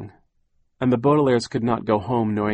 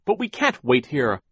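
put-on mildly urgent, higher girl's voice; crying out; 5 semitones higher than usual. A narrator's reading. Violet protests at being left waiting, mildly crying out. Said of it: "Violet cried".

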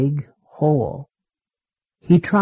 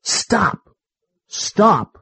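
mild narrated yell, pitched higher; 4 semitones higher than usual. A narrator's reading. Monkey halts the sheep's rescue, mild higher-pitched yell. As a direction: call it out with restrained force. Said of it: "The monkey yelled".

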